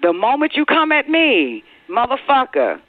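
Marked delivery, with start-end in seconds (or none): none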